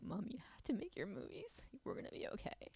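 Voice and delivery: quiet, tearful son's words; choked up, almost whispering; crying. Retold or imitated. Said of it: "my son's like".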